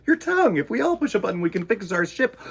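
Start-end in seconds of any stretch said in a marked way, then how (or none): 0.1-0.6 high squeaky answer; high silly answer. Acted. Storyteller answering "with your what", high and playful.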